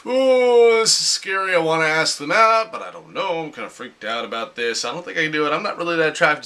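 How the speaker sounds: high, loud nervous fluster at the start, settling down; mock nervous crush. Across the room from a crush, a hammed-up nervous fluster. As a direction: ham up the panic of asking someone out. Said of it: "you're like".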